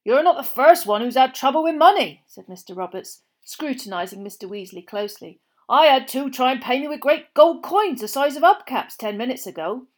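eye the stranger and grumble about odd payers; the campsite owner tells Mr Weasley about the strange coins; suspicious campsite owner. A narrator's reading. Roberts scrutinising Weasley.

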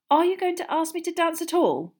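high, loud impatient question. A narrator's reading. Neglected partner asking boy for a dance, impatient.

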